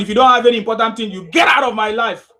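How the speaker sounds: loud, rushed rant; angry fast shout. Real, not acted. Host yelling at a caller to make his point, fast angry shout.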